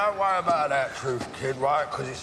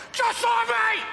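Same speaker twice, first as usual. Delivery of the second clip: furious cry; 13 semitones higher than usual. Acted. Alfie shouts at Tommy that he is a killer too. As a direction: hurl it at him. Alfie's rant reaches its peak.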